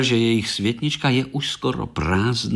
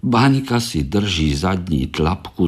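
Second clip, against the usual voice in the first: ordinary delivery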